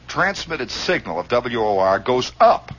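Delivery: usual speaking voice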